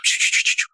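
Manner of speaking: voiceless shh shh whispered into an ear; whispered shushing. Acted. Moses whispering prompts to Aaron, voiceless shushing. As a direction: hiss a secret prompt under your breath. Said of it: "Jadi Musa bisik ke Harun".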